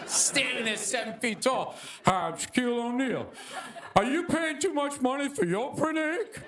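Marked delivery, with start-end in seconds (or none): 2.1-6.4 TV pitchman impression. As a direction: pitch it like a celebrity ad read. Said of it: "[name] was selling me printer ink".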